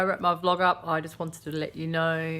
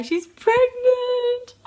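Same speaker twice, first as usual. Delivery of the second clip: high, loud, stretched-out squeal; excited squeal; 10 dB louder, much slower than usual. Real, not acted. Vlogger squealing that her favourite YouTuber is pregnant. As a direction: squeal the news with delight.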